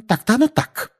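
high, clipped grudging agreement; mother grudgingly conceding; tight-lipped concession. Acted. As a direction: say it with pursed lips. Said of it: "поджала губы".

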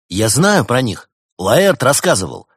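loud, eager outburst, high pitch; excited. Acted. A listener proudly recalls Laertes' stories of rebel robots, excited. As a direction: chime in loudly and eagerly. Excited child chimes in with knowledge.

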